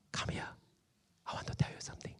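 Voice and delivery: hushed, inviting whisper; soft, intimate acted whisper. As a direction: whisper as if drawing someone close. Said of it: "we will hear his whisper".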